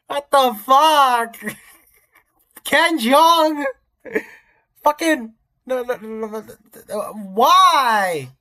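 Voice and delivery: high, loud disbelief; real incredulous shout. Real, not acted. Streamer seeing Ken Jeong as Silver, incredulous high shouting. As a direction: cry out, drawing out the key words.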